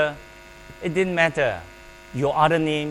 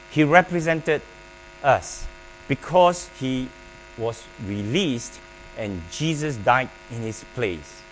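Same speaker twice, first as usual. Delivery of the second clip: ordinary talk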